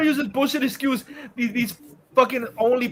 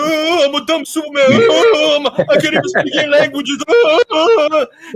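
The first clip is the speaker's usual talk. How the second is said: higher, silly mock voice as a dumb Superman; 5 semitones higher, 4 dB louder than usual. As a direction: play the hero as a fool, with a sneer. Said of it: "he was mocking".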